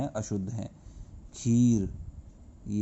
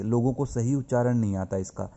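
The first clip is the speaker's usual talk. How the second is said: normal talking voice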